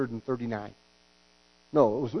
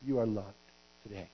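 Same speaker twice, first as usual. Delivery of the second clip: gentle God tag; 5 semitones lower, 6 dB quieter than usual. Retold or imitated. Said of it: "he says".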